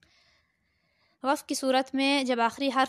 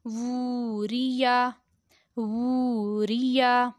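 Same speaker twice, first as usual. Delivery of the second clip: slow recitation with long-held vowels; Qaida word recitation; much slower than usual. Shown as a demonstration. Teacher reading Qaida words to pupils, slow recitation. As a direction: draw each word out evenly.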